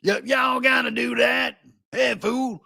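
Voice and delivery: high, put-on caricature voice; mocking impression of pundit. Host contrasting the pundit's clothes with his talk, mock voice. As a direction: holler it in exaggerated street talk. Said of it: "And yet he talks like".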